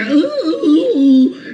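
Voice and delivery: high mock little-girl coo; a mocking girly "ooh"; David Pakman. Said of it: "he talks like a little girl".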